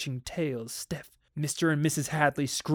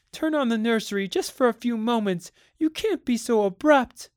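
high-pitched pleading, louder toward the end; 8 semitones higher, 4 dB louder than usual. A narrator's reading. Wife begging husband to turn on the nursery, pleading. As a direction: beg him in a raised pitch.

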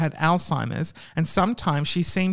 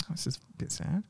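whispered aside; 13 dB quieter than usual. Real, not acted. Aside while reading sad story.

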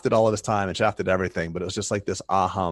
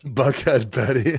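higher, slow, laughing echo of a nickname; amused, laughing repeat; 4 semitones higher, 5 dB louder, slower than usual. Real, not acted. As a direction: repeat it while cracking up.

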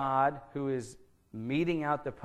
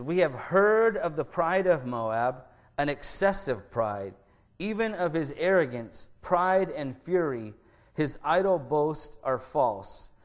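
scripture reading; 4 dB louder than usual. Acted. Pastor reads Isaiah's prophecy to the congregation, solemn and measured. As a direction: read the verses out with weight to the congregation.

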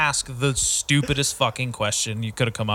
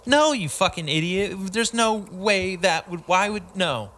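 higher, drawn-out mock telling-off; mock exasperation; 6 semitones higher, slower than usual. Coach voicing blunt disbelief at an idiot question, exasperated. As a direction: coach wishing they could insult you, exasperated. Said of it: "they can't just be like".

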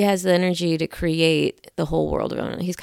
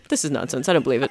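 lightly voiced straight man, lower pitch; 5 semitones lower, 4 dB quieter, much faster than usual. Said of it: "He's like the straight man from Monty Python sketches".

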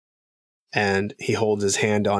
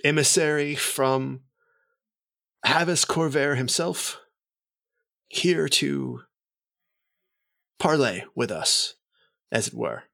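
courtly noble announcing a guest, lightly raised pitch; formal announcement; 3 semitones higher than usual. Acted. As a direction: present the emissary with ceremony. GM voices noble introducing emissary.

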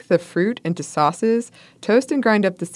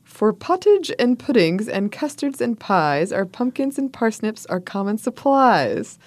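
lilting rhymed verse, pitched higher; poem recital; 4 semitones higher than usual. Acted. Host reading a Pilgrim poem to co-host, sing-song recital. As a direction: recite it in measured couplets, raised pitch. Reads an old poem aloud.

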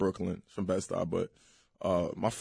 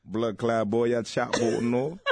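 Jamaican patois; playful mock Jamaican; Jamaican accent. Shown as a demonstration. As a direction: talk like a Jamaican islander.

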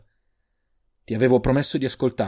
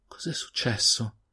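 softer, slow, close to tears; trembling voice; 5 dB quieter, slower than usual. A narrator's reading. Brother kneeling by his hurt sister, tearful. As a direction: ask it fighting back tears. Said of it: "disse con voce tremante".

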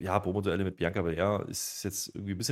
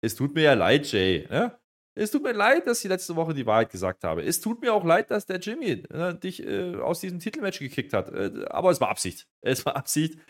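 lightly acted apology, higher and louder; mock Heyman apology; 6 semitones higher, 4 dB louder than usual. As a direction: play a fake, over-sorry apology, climbing in pitch. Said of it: "er entschuldigt sich jetzt".

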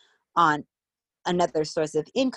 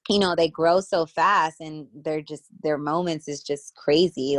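normal talking voice

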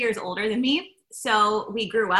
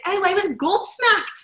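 high-pitched, drawn-out British exclamation; put-on British voice, pitched up and slow; British accent; 10 semitones higher, 4 dB louder, slower than usual. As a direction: imitate an astonished sister in a British accent. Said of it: "she has this British accent".

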